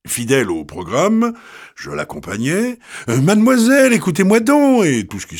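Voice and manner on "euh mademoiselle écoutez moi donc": pushy come-on, voice lifted much higher; insistent flirting.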